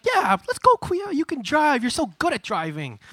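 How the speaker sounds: put-on high, excited kid-brother voice; eager little brother. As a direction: cheer him on in a squeaky, high pitch. Acts out little brother's eager agreement.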